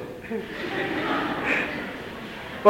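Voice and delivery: short soft laugh, barely voiced. Real, not acted. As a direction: let a quiet laugh slip out at the joke. Laughs at the elder's retort.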